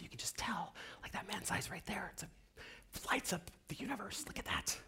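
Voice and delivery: awed, breathy whisper. Retold or imitated. Conductor admiring a listener's eyes, whispering.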